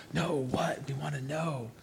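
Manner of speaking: hushed, breathy mock curiosity; mock whisper. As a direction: whisper it like eager gossips. Mock eager listeners.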